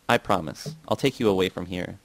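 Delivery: plain reading voice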